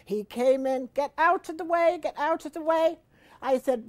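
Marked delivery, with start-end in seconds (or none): 0.9-3.0 higher, louder, pushy retold husband; impatient husband. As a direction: shove past as the impatient husband. Husband aggressive at blocked toilet.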